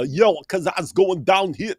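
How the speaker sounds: mock British accent, drawn out; dumb-sounding British accent. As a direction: put on a dopey British accent. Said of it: "British accents that sound real stupid".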